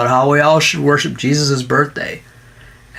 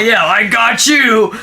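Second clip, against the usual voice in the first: gloating voice; 7 semitones higher than usual. Pretend. Mocking triumphant protester.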